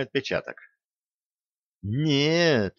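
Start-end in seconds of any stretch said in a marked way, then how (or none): 1.8-2.7 higher, apologetic voice; mildly guilty tone. A narrator's reading. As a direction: admit it sheepishly. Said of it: "виновато сказал Драгобич".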